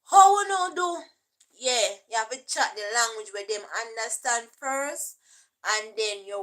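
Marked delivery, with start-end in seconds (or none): 0.1-1.0 higher, louder patois hail; patois greeting. Retold or imitated. Teacher greeting pupils in patois, loud and bright. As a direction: call it out cheerfully in patois. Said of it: "Teacher have to start to say".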